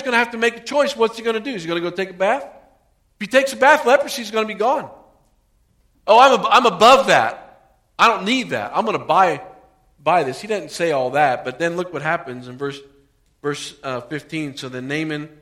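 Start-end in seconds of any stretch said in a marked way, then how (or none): 6.1-10.4 loud, proud, above-it-all; loud, scornful brush-off of the cure; haughty refusal. Retold or imitated. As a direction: scoff loudly at the simple cure. Preacher voices proud Naaman.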